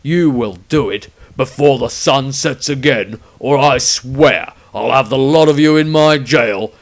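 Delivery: loud, threatening command; stern ultimatum. A narrator's reading. Witch hunter addressing the whole group, loud threat. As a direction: make the threat plain.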